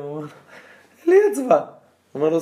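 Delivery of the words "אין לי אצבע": high, loud cry of disbelief; shocked cry. Retold or imitated. King to the dancing man after losing his finger, shocked. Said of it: "מסתכל עליו בהלם".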